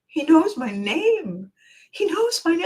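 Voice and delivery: high, slow, awestruck inner voice; breathless delight. Retold or imitated. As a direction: savour the thrill. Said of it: "And I thought".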